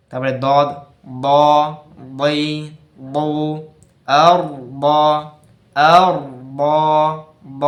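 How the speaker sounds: দ, বই, বউ said firm, আর pushed out loud; loud firm আর with no breath escaping; letter drill. Shown as a demonstration. Teacher drilling দ and ব letters, firm voiced. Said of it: "বাতাস বেরায় না কিন্তু".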